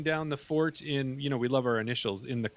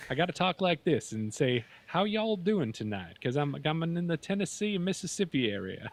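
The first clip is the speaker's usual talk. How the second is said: put-on Southern US accent; 3 semitones higher than usual. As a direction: talk like a Tennessee local, playful Southern twang. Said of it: "that is a Southern accent".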